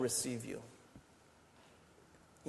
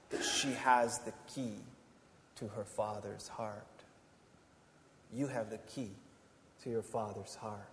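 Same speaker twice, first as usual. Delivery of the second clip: soft, low, intimate pastoral voice; tender reassurance; 4 semitones lower, 5 dB quieter than usual. Acted. Pastor softly reassuring the congregation they are loved. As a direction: ease them into rest and comfort.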